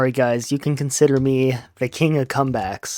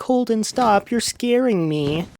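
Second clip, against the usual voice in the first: higher-pitched mock plea from Sally; scared plea; 8 semitones higher, slower than usual. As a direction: plead in a raised voice, lightly acted. Acting out frightened Sally's quote.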